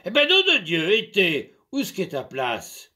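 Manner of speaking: loud, high angry yell; furious shout. Acted. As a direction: shout as if to murder her. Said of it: "Il criait comme s'il allait la massacrer".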